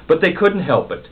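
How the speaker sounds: ordinary delivery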